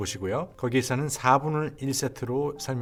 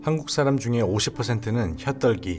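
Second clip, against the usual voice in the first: ordinary delivery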